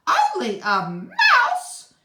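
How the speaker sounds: a tiny mouse insulted, crying out; indignant mouse cry. A narrator's reading. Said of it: "cried the little animal indignantly".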